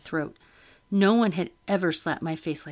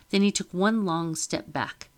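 plain reading voice

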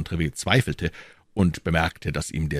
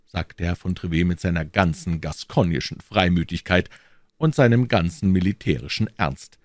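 usual speaking voice

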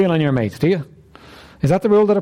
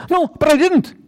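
mock protest; 11 semitones higher, slower than usual. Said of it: "And you say".

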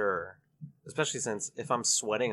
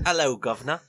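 loud, higher mock British greeting; British accent; 4 semitones higher, 8 dB louder than usual. Shown as a demonstration. Friend showing off a bad British accent on request, loud British accent. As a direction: belt out a put-on British accent. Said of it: "Let's hear it".

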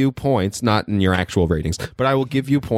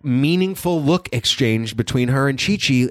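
usual speaking voice

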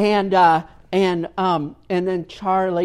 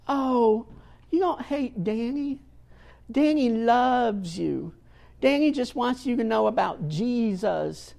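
gentle coaxing, pitched up; kindly lady; 6 semitones higher than usual. Retold or imitated. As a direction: imitate a kindly lady soothing a child. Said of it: "kindly, uh, lady that ha-, has a way with children".

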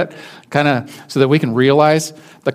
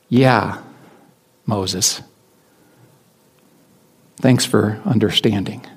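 grateful God; 5 semitones lower, slower than usual. Retold or imitated. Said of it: "And God's like".

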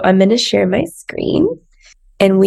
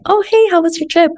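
high, quick, overly sweet "how was your trip"; fake breezy greeting; 10 semitones higher, faster than usual. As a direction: chirp it like nothing is wrong. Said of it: "you're like".

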